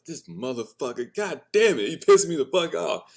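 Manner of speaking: high, loud mock outrage; mock anger. Angry thoughts about his dad, loud mock fury. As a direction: rant like you're fuming.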